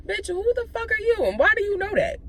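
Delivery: high-pitched, incredulous demand; indignant challenge. Retold or imitated. Retold challenge to a stranger.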